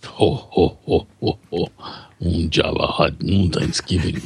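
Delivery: low, slow alien crime-lord voice; big slug gangster impression, pitched low; Jabba the Hutt. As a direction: chuckle low, then talk in a deep alien drawl. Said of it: "let this be a Jabba the Hutt thing".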